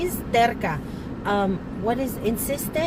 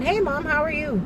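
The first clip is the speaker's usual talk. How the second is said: cheerful son; 6 semitones higher than usual. Retold or imitated. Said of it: "So he walks in".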